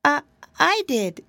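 high, hesitant little pig; a pig boy caught off guard; nervous squeak of a piglet; timid piglet. Acted. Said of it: "said Flop Ear".

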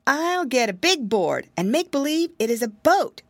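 put-on wary piggy boy musing to himself; piggy boy thinking. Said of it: "said Curly Tail".

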